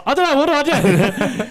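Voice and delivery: high, panicky mock wail; panicked. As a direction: squeal it out in a laughing panic. Retells his own panic.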